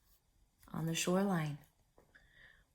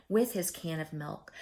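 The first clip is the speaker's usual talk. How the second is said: plain reading voice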